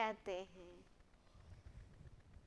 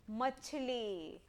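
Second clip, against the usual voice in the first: slow model word; 3 semitones lower, 7 dB quieter, much slower than usual. Shown as a demonstration. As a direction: stretch out मछली slowly, syllable by syllable. Teacher models the word slowly. (मछली धीरे-धीरे)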